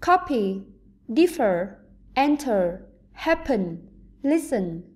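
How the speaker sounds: "copy", "differ", "enter", "happen", "listen", each stressed on the first syllable; strong opening syllable, weaker second in "copy", "differ", "enter", "happen", "listen"; word-list demo, beat up front on "copy", "differ", "enter", "happen", "listen". Shown as a demonstration. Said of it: "có trọng âm rơi vào âm tiết thứ nhất".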